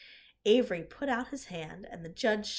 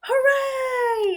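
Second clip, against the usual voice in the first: very high, loud cheer; crowd yelling; 9 dB louder than usual. A narrator's reading. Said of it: "yelled the crowd".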